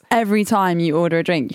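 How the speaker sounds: usual speaking voice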